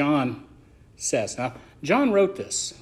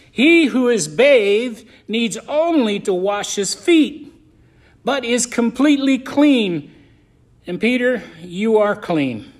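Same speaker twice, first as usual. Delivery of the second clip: slow, louder reading, punching "bathed", "feet" and "clean"; emphatic Bible reading; 4 semitones higher, 5 dB louder, slower than usual. Real, not acted. Pastor reading Jesus' words to the congregation, emphatic. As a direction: lean hard and slowly on "completely clean". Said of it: "I can put emphasis on it".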